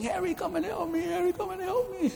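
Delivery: very high, thin-volume cry, repeated; acted shouting for help. Wounded Bill keeps calling Harry from the battlefield, high acted shout. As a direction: call out high and desperately without real volume. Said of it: "Bill kept shouting".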